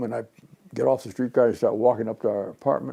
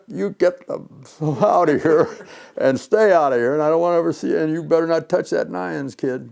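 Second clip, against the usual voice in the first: loud, raised-pitch mock tirade; imitated angry ordering-out; 5 semitones higher, 5 dB louder than usual. Company owner throws Jimmy out of his office, mock angry warning. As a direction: play a boss throwing someone out with a warning.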